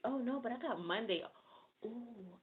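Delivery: ordinary delivery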